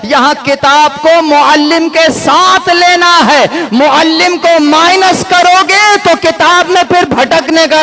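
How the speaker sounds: emphatic sermon building to a climax; impassioned preacher, ending on a ringing call; forceful preaching. Real, not acted. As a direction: drive the point home to the congregation.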